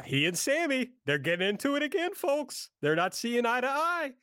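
high singsong with rising phrase ends; mocking recap voice. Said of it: "I wouldn't say it in that voice".

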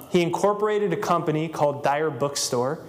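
ordinary talk, nothing marked

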